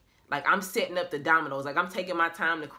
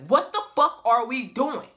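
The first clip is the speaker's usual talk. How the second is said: exasperated Noah; 6 semitones higher, 7 dB louder than usual. Retold or imitated. Noah questioning.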